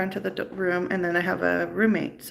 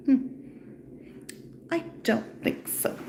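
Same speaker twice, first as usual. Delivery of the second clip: high, slow, breathy dismissal; the doctor refuses with a snotty air; 6 semitones higher, 5 dB quieter than usual. Retold or imitated. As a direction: dismiss her with snotty disdain. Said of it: "she was really snotty about it".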